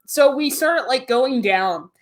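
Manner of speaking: ordinary delivery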